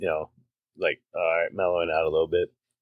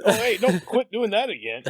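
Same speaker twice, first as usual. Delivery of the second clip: high-pitched hammed-up telling-off; mock scolding; 11 semitones higher than usual.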